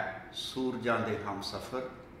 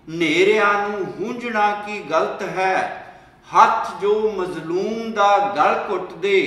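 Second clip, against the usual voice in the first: declaimed poem, louder than plain talk; poem recitation; 4 semitones higher, 9 dB louder than usual. Acted. Poet to young comrades about oppression, impassioned poem recital. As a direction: recite in measured lines, lifting the rallying calls.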